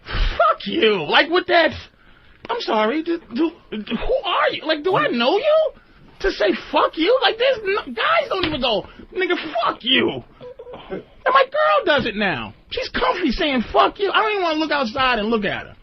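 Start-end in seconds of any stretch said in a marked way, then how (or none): 0.0-1.0 higher mock nagging girlfriend; imitating girlfriend. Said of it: "like, eight times". 1.0-15.2 much higher, worked-up disbelief; exasperated rant. Real, not acted. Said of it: "the exasperation in my voice".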